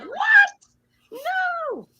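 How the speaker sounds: shocked disbelief. Retold or imitated. Friend retelling news heard on the radio, shocked disbelief. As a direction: act out hearing terrible news.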